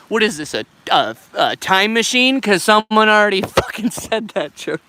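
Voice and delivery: loud, drawn-out mock exasperation; mock outrage. Streamer calls out a viewer's recycled joke, mock outrage. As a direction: play up being fed up, loudly.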